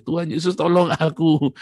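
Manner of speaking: loud, high plea; spontaneous cry. Retold or imitated. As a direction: blurt out a cry for help. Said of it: "Spontan itu ya".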